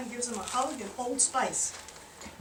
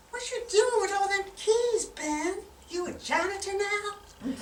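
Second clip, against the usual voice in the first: high, teasing wife's voice; mock suspicious wife; 10 semitones higher, 3 dB louder than usual. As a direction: needle him like a suspicious wife. Wife teasing Ben about keys.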